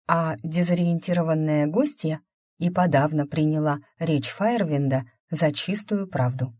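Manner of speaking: ordinary delivery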